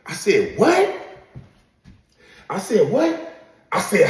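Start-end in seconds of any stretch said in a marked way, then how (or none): 0.6-1.0 high, loud stunned what; shocked what. Retold or imitated. Hears girlfriend cheated with connect. 2.9-3.3 high, disbelieving what; stunned repeat. Retold or imitated.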